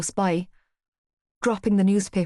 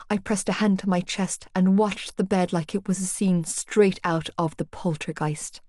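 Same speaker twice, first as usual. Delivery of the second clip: ordinary delivery, nothing marked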